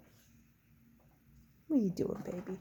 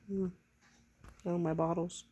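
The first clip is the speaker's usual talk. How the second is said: ordinary talk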